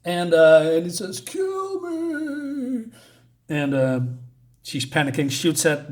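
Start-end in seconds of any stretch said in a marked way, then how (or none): 1.2-2.9 high, eerie, otherworldly voice; ghostly plea. Acted. Unseen figure behind the door begs, eerie and high.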